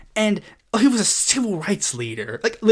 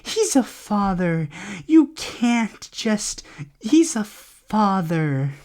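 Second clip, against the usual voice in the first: mock pleading; 3 dB quieter, much slower than usual. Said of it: "they even go so far as to say".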